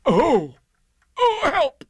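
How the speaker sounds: high, loud yelp for help; alarmed cry. A narrator's reading. Pooh finds himself stuck in hole.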